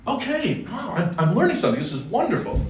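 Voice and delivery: high, quick, delighted; impressed teen. Retold or imitated. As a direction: gush like a teenager who is learning. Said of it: "I'd be listening and going".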